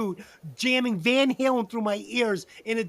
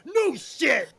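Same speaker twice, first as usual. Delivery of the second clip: emphatic, higher-pitched mock-serious exclamation; 5 semitones higher than usual. Retelling the pizza night to prove seriousness, mock-serious. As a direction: play it as if dead serious. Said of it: "that I was serious, I went".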